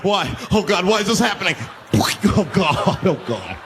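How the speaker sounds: singsong wailing lament; hammed-up terrified passenger; mock despair. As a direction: wail to the heavens. Victim despairs.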